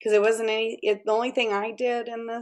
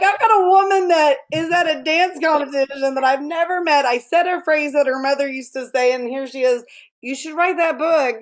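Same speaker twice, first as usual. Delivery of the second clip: higher, louder, laughing and close to crying; overwhelmed, laughing through tears; laughing, near tears; 7 semitones higher, 6 dB louder than usual. Retold or imitated. Overwhelmed retelling of the sign.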